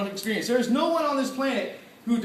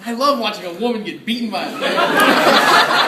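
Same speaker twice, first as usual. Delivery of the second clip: laughing; 7 dB louder than usual. Real, not acted. Laughing at own bad phrasing.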